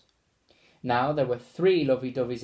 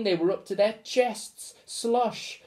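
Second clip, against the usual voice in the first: ordinary delivery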